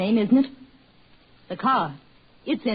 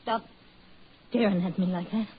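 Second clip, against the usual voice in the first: ordinary talk, nothing marked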